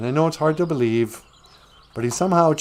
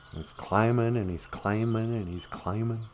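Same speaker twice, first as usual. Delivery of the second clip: fading away; 2 semitones lower, 9 dB quieter than usual. A narrator's reading. Climbing on and on, fading.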